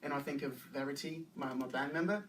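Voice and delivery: tentative Australian accent; conversational Aussie English. Shown as a demonstration. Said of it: "It's Australian accent".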